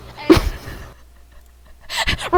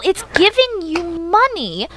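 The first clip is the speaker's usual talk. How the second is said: usual speaking voice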